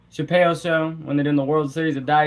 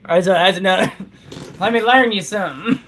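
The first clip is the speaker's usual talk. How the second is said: put-on country accent; 3 semitones higher than usual. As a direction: spin a moonshine yarn like an old Southern country boy. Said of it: "I'm gonna get you to talk country".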